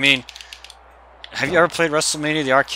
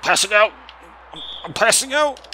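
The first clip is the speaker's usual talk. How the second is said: imitating J R; 9 semitones higher than usual. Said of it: "J R's like".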